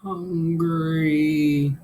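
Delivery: high, far-off hungry moan. Acted. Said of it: "you moaned".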